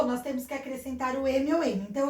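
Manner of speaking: ordinary talk, nothing marked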